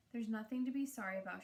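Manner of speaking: ordinary delivery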